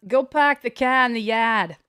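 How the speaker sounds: park the car with no R, pahk the cah; Boston accent. Shown as a demonstration. Streamer attempting Boston accent for chat. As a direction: drop every R, Boston style. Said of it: "Never pronounce your ass".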